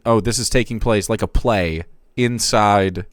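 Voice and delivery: normal talking voice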